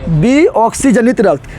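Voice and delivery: विऑक्सीजनित said slowly and clearly; dictating विऑक्सीजनित to students; slow new term. Shown as a demonstration. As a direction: lay out विऑक्सीजनित syllable by syllable. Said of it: "teacher repeats new term".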